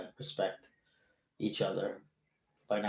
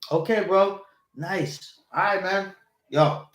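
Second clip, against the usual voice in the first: higher, louder chipper goodbye imitation; mock polite brush-off; 8 semitones higher, 7 dB louder, slower than usual. Imitating a friend politely ending a chat, mock cheerful goodbye. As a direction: play the guy trying to wrap up, lightly mocking. Mimics someone ending conversation.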